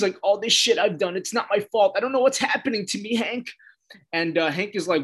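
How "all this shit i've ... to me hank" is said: mock tearful. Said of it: "in full tears".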